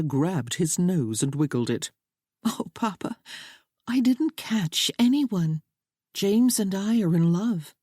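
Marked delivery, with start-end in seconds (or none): 2.4-7.7 higher lightly voiced young woman, teasing then insistent; playful daughter. A narrator's reading. Daughter teasing her papa about catching husbands, bright insistence. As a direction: laugh off his joke, then declare your love.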